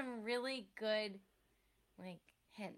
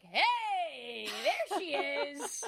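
slow, leering higher-pitched guy voice; aroused guy; 4 semitones higher, 5 dB louder, much slower than usual. Retold or imitated. Aroused boyfriend greets his furious date with a leer. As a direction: drag out a creepy, turned-on greeting. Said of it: "a hard on and is like".

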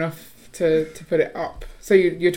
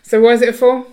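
loud; 5 semitones higher, 10 dB louder than usual. Real, not acted. Challenging husband's rating.

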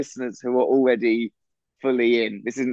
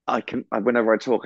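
ordinary talk, nothing marked

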